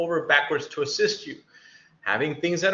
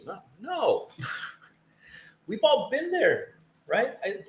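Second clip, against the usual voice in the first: ordinary delivery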